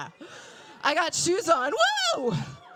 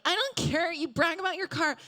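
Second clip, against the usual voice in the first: bickering sister; 8 semitones higher, faster than usual. Retold or imitated. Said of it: "she was like".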